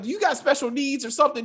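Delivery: put-on high, teasing kid voice; mock childlike reply. Mocking the actress back in her kid voice. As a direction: answer her in her own babyish tone. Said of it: "I was like".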